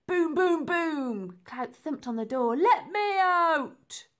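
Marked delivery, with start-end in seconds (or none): 0.1-1.4 loud, high pounding booms; booming thumps. A narrator's reading. Narrator voicing the prisoner's fists on the door, loud booms. 2.6-4.1 loud, high, slow yell from behind a door; desperate shout. Acted. Locked-in man shouting through the door, loud and high.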